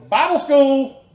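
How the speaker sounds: loud, slow and gleeful; delighted memory of Bible school; excited. Real, not acted.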